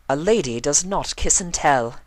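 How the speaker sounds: high, prim and proud; high prim ladylike voice; haughty lady. A narrator's reading. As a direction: lift your chin and refuse to tell. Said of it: "held her head high".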